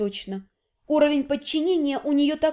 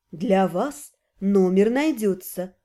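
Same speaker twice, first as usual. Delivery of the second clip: slow, low, husky purr; husky seductive voice; 7 semitones lower, slower than usual. Acted. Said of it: "с волнующими хрипловатыми нотками".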